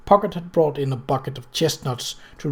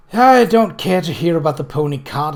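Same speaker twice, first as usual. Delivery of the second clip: louder, petulant complaint; pouting; 7 dB louder than usual. A narrator's reading. Amelia to Mudd, wanting the gun story, pouting louder. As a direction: sulk it out, spoiled and coy. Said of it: "Amelia pouted".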